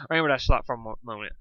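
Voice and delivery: ordinary delivery, nothing marked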